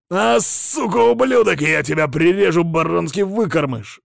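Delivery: loud, high, enraged yell; furious shout. Acted. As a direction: roar curses, wounded and furious. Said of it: "закричал раненый наёмник".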